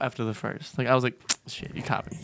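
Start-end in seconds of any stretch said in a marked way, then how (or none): 1.5-2.2 whispered, sheepish aside; hushed admission. Retold or imitated. Student caught talking by the teacher, sheepish whisper. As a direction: whisper it like you've been caught.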